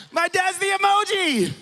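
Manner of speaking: high, drawn-out excited squeal; gleeful reveal. Real, not acted. Preacher showing the crowd dad's emoji, high gleeful squeal. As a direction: squeal the punchline, stretching it out.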